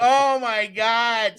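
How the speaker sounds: high, loud, very drawn-out disbelief; retold shocked reaction. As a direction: imitate an appalled wife, stretching each word. Wife reacting to the shouted greeting.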